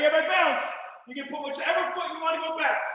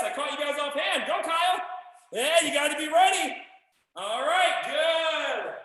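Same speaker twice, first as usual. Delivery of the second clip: usual speaking voice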